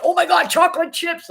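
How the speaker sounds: high, loud burst of re-enacted delight; gone crazy with excitement. Telling viewers how she spotted cheap chocolate chips, crazy excited. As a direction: exclaim it high and loud, thrilled. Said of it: "I went crazy".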